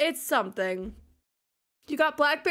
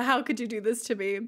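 usual speaking voice

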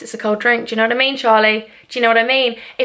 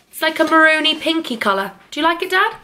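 ordinary talk, nothing marked

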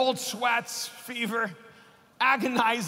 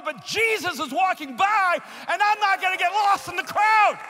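bold answer; 7 semitones higher than usual. Retold or imitated. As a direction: proclaim it like the beggar calling out. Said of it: "And you can respond".

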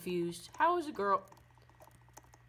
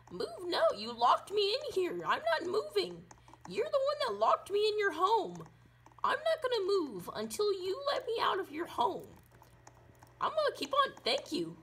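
much higher, a whiny refusal; high whiny stubborn voice; 11 semitones higher than usual. Acted. To the girl who locked them in, high whiny refusal. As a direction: dig in like a stubborn kid in a high voice.

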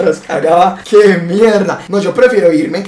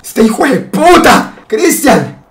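indignado; 5 semitones higher, 4 dB louder, much slower than usual. Retold or imitated. Regaño indignado al primo.

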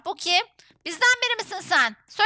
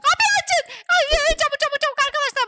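high, frantic yelling to hurry; playful panic; 9 semitones higher, faster than usual. Pretend. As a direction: panic as if about to get caught. Trapped while fleeing. (telaşla tiz sesle bağırma)

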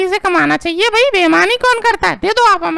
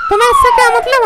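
sharp, high indignant retort; angry; faster than usual. Acted. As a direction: snap back much higher, offended. Wife snaps at intruding man.

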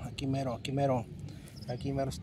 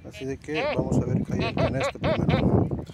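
raised, louder voice, thrilled at the first signal; excited; 9 semitones higher, 4 dB louder than usual. Real, not acted. Telling viewers about the first tone, excited. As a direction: pitch jumps up as the detector beeps. Metal detector finds first tone.

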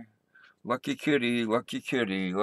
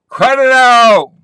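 a loud, high, slow "Cut it out."; telling off; 9 semitones higher, 14 dB louder, slower than usual. Real, not acted. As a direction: bark it out to stop the animal. Yelling at the animal.

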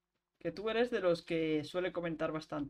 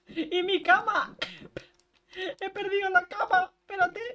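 high, loud lost-bed panic; panicked; 10 semitones higher, 6 dB louder than usual. Real, not acted. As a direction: fret out loud.

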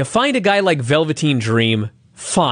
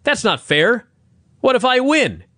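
Larry objecting to Russo, a higher, hammed-up protest; mock Larry protest; 7 semitones higher than usual. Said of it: "Larry goes".

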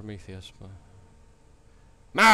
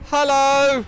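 high, loud call into the dark; 12 dB louder than usual. Real, not acted. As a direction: shout it down the empty corridor. Calling out in empty building.

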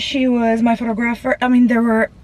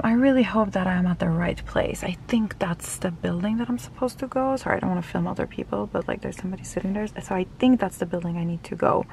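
hushed; 2 semitones lower, 8 dB quieter than usual. Real, not acted. Vlogger near strangers she doesn't want to film, hushed. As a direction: keep it down so others don't hear.

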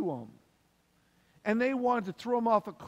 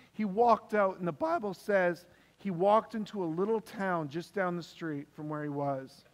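normal talking voice

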